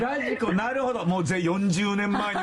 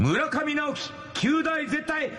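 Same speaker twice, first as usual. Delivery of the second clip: cheer for success; 12 semitones higher, slower than usual. Acted. DJ shouting a good-luck exam cheer for a listener's son. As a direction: belt it out like a pep rally cheer. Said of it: "絶対合格コールをお願いします".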